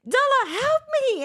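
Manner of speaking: high, desperate mock wail; hammed-up frantic begging; retold plea for help. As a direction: call to the child in a squeaky panic. Acts out begging older child for help.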